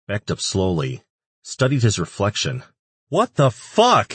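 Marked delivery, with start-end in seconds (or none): 3.1-4.1 shocked shout, much higher and louder, slow. A narrator's reading. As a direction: yell it at your reflection in disbelief. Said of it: "he shouted".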